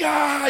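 high startled "ah"; mock waking cry. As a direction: imitate jolting awake with a high yelp. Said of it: "he just woke up again".

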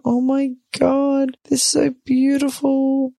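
high, slow gushing over babies; melting, drawn-out high voice; adoring coo. Retold or imitated. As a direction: coo at tiny cute faces.

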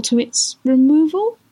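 plaintive question. Acted. Lady offering Jingle help, plaintive rising "removal". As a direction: ask "removal" as a tentative, lifted question. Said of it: "said the lady in a plaintive voice".

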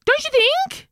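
high, slow, camp delight; camp exclamation. Retold or imitated. Mother retelling her camp reaction to her kids, high and slow. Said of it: "And I said".

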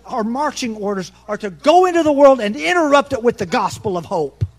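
high, loud, driving sermon call; rally the church like a revival preacher; preaching at full force. Acted. Pastor urging his congregation to go, loud preaching.

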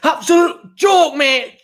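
high, loud mock rant; imitating angry fans. Podcast host imitating fans' reaction videos, angry rant. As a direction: shout it like a fuming fan, drawn out. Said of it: "honestly, it goes from".

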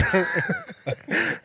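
high real laughter. Real, not acted. Retelling the pub joke to mates, high laugh. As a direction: breaks up laughing again.

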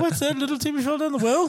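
high, loud hammy cartoon hero voice; bad acting. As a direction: ham it up like an old TV rescue.

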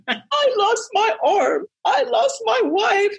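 high, slow, anguished movie-hero lament; Nicolas Cage impression. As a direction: ham up Cage's tragic wail. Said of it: "he says".

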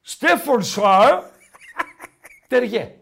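slow, exaggerated mock read-out; drawn-out reading. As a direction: belt it out slowly, rising at the end. Repeats the listener's breed name.

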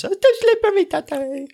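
very high mock kid squeal; squeaky falsetto child impression; whining child. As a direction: imitate a little kid complaining to Daddy. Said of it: "just didn't like the ice".

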